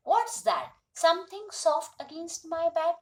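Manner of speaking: high, loud startled question; acted surprise. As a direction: react as if something has just touched you. Girl feels something behind her, startled. (चौंककर पूछने का अभिनय)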